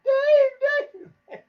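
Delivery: imitated high, loud panicked screaming; mock terror, yelling for his guide; screaming bloody murder. Stranded hunter yelling for Dave, in an imitated high panicked scream. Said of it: "screaming bloody murder".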